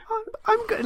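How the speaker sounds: small, high, wounded voice; tiny voice. Retold or imitated. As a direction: squeak it out like a kicked puppy. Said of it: "in a tiny voice was like".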